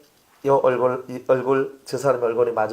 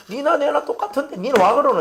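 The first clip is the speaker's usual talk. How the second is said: higher, louder indignant challenge; indignant retort; 11 semitones higher, 3 dB louder than usual. Retold or imitated. Pastor mimics someone bristling at a peer. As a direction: fire it back as an equal. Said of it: "이렇게 나오잖아요".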